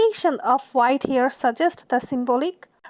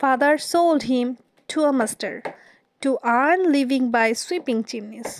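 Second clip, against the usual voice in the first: slow poem reading; much slower than usual. Shown as a demonstration. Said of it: "তার বাবা তাকে বিক্রি করে দেয়".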